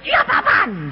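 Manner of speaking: high, mock-startled shout; startled yelp. Wife woken by husband's hand, startled yelp. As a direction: jolt awake with a sudden high cry. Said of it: "Nanti dia kaget".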